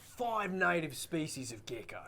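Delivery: whispered gecko voice; low hissing gecko impression. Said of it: "It was a very good impression of a gecko".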